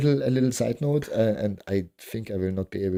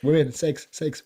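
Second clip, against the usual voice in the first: usual speaking voice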